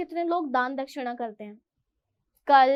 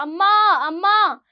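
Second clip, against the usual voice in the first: calling out loud; 3 semitones higher, 6 dB louder than usual. Retold or imitated. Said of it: "गला फाड़ फाड़ के चिल्ला रहा था".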